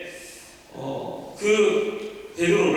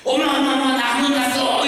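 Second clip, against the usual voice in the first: put-on high, louder little girl calling excitedly; bragging child; 8 semitones higher, 4 dB louder than usual. Little daughter bragging to her mother, high and excited. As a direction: crow happily like a relieved kid. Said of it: "엄마한테 막 자랑하는 거예요".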